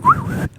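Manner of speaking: short, very high whistle; whistling. Retold or imitated. Worker catching a man's attention on site, whistling. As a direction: imitate whistling at someone. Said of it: "like whistling".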